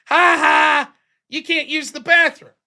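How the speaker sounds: retold bathroom-door taunt, mocking. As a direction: tease him like a smug roommate.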